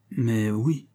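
hesitant, understated stammer; faltering. A narrator's reading. Witness answering his accused friend, faltering. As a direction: admit it uneasily, faltering. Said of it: "balbutiai-je".

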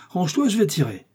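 high, urgent cry; shouted warning. Acted. Athos about to shoot through the door, crying a warning. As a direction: warn them clear before firing, pitched high. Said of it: "cria Athos".

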